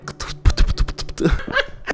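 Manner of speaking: rapid unvoiced tuh-puh-tuh-puh chopper noise; helicopter imitation. Shown as a demonstration. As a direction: sputter like helicopter blades with the lips and tongue. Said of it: "like a helicopter. It's like".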